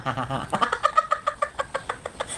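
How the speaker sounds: breathy hissing laugh under the breath; unvoiced giggle. Real, not acted. Vlogger snickering at her own cleverness, breathy giggle.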